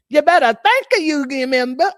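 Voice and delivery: put-on hammy Southern accent. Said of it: "let me get my Southern accent right".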